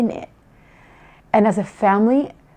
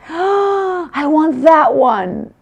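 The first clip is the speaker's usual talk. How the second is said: put-on excited child; 8 semitones higher, 3 dB louder, much slower than usual. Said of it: "And she says".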